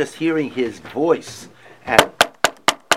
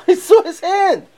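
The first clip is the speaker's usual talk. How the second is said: much higher, louder, slow, with a laugh; laughing awe; 12 dB louder than usual. Acted. Teacher repeating the bride's words with laughing awe. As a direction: burst out in delighted wonder.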